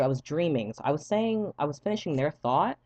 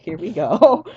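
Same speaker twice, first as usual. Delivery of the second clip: exasperated; 4 semitones higher, 11 dB louder, slower than usual. Retold or imitated. Nurse starts long interrogation.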